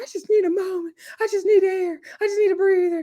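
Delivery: high, teary whine imitating Summer; Summer acted out near tears, pitched high; mock crying. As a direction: play her sobbing plea for air, hammed up. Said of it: "And so Summer's crying, and she's like".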